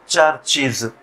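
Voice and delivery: usual speaking voice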